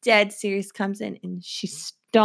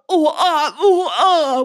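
higher, louder laughing yelps of a falling woman; imitated yelps; 7 semitones higher, 7 dB louder, slower than usual. Imitating a woman falling off a wine barrel, with laughing yelps. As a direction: yelp like the lady falling off the barrel. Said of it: "she's all".

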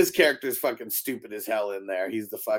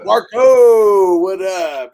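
shouted greeting, much louder; loud call; 6 semitones higher, 14 dB louder, much slower than usual. Retold or imitated. Retelling a movie greeting, shouted loud.

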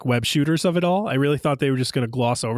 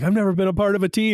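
wistful confession; 6 semitones higher, faster than usual. Retold or imitated. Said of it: "So they're like".

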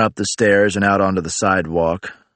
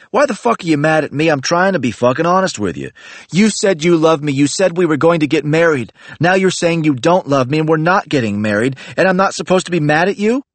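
heated protest; 9 semitones higher than usual. Acted. Narrator arguing with Libby over breaking off marriage, heated high rant. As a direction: throw her words back at her, fed up.

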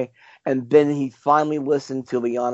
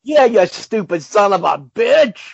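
loud, drawn-out, higher-pitched mock abuse; mock outrage; 6 semitones higher, 6 dB louder, slower than usual. Podcast host ribbing a co-host over old ground, mock outrage. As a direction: pile on with slow, hammed-up indignation.

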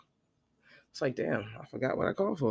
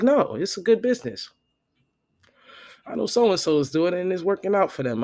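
higher, louder insistent friend voice; mock pushy friend; 5 semitones higher, 6 dB louder than usual. Friend pushing the hookah business, higher and louder.

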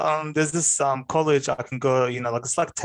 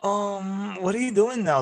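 high, sing-song patronizing teacher; mock her teacher's talking-down question, pitched high; mimicked condescending tone; 8 semitones higher than usual. Teacher asking what the dropout does now, patronizing. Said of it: "speaking to me with this tone".

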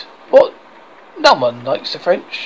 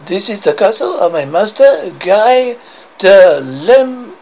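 put-on outrageous French accent; 5 dB louder than usual. French guard names his master to Arthur, outrageous French accent. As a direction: announce grandly in a comic French accent. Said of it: "I have this outrageous accent".

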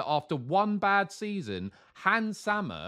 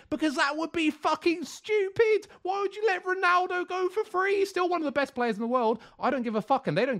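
high-pitched incredulous rant; exasperated outburst; 14 semitones higher, 3 dB louder than usual. Real, not acted. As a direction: voice shooting up in sheer disbelief. Heated rant at an absurd idea.

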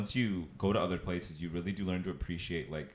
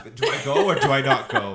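louder, higher, laughing mock panic; mock dithering driver; 6 semitones higher, 13 dB louder than usual. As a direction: ham up the indecisive driver. Mocking hesitant drivers.

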